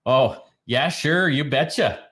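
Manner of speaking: sing-song North Dakota twang; Upper Midwest accent. Pretend. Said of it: "That's more like North Dakota".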